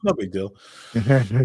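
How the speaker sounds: drawn-out, very slow mock-casual no big deal; mock nonchalance. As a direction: stretch it out like it was nothing, teasing. Repeats it, slowed, mock modesty.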